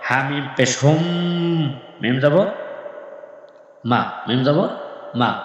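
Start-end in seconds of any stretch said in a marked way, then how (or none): 0.8-1.6 "হুম" drawn out on a higher, nasal m; obligatory ghunnah. Shown as a demonstration. Teacher giving an example of ghunnah, "হুম" with a long hummed m. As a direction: hum through the m of "হুম" and hold it. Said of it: "তাহলে ওয়াজিব গুন্না হয়".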